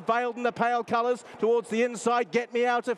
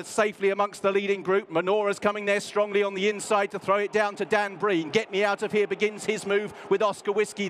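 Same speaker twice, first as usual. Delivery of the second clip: ordinary talk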